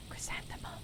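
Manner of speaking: unvoiced-sounding, very soft whisper; faint whisper. Lonely caller fading out on the phone, whispered.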